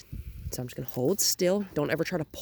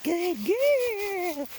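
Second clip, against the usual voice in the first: much higher, bright praise for the dog; 13 semitones higher than usual. Real, not acted. Trainer praising the puppy in a high voice. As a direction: lift way up to praise her.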